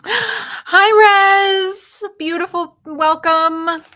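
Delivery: high, loud greeting after a gasp; excited welcome. Real, not acted. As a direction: greet the newcomer high and loud, winded from laughing. Greeting an arrival amid laughing fit.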